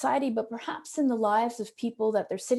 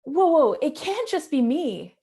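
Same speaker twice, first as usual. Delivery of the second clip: higher, louder "whoa, whoa" cutting in; retold protest, pitched up; interjecting objection; 5 semitones higher, 6 dB louder than usual. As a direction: imitate a student calling out in protest. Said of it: "this voice comes in and says".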